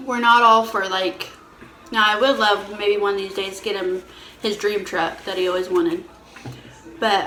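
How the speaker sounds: normal talking voice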